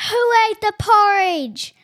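slow, loud, high bear roar; papa bear roaring at the intruder. A narrator's reading. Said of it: "roared a deep bear-like voice".

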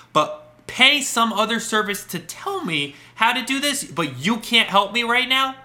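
high, slow, incredulous retelling with big pauses; very dramatic. Retold or imitated. Customer confronting tech support in a retold call, very dramatic. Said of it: "Wow, that was very dramatic".

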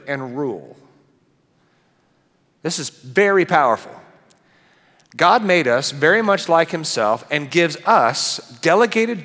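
preaching at full force. Acted. Pastor preaching God's image to the congregation, full force.

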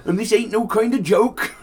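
high, loud mock protest; indignant shout played for laughs. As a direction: act out the defendant's outburst with laughter behind it.

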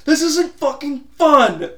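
high, loud, drawn-out outburst; imitated yelling. Imitating a hockey player stuck in the sand, frustrated yell. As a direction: yell it slow and high, mimicking a fuming golfer.

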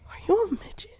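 high, breathy whisper; whispered joke. Retold or imitated. Teasing the growly kid in a whisper. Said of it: "I'm like".

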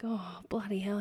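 muttered under the breath in a whisper; retold whispered curse; whispered exasperation. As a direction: whisper it, resigned and fed up. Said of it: "And I'm like".

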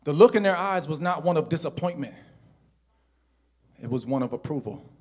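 normal talking voice

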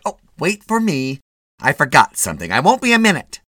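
high, loud scolding wife; a shrill-voiced fussing wife, loud; nagging wife. A narrator's reading. Mrs Mitty at drugstore.

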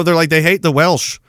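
normal talking voice

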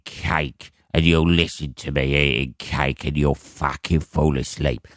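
low, slow troglodyte ASMR host; Fat Rita. Acted. Cake-eating troglodyte relaxing ASMR listeners, in a low, slow mock voice. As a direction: drag it out low, a mock attempt at soothing. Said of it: "It's not like some fucking troglodyte or whatever sitting there".

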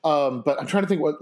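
ordinary delivery

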